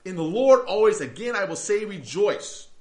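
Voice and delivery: rejoice in the Lord called out loud and high; loud command. Retold or imitated. Preacher booming Paul's command to rejoice. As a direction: proclaim it like Paul commanding the church. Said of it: "he tells these people".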